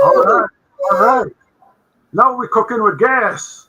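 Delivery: loud, high triumphant cheer; excited. Real, not acted. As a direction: celebrate the win out loud. Screen share finally works.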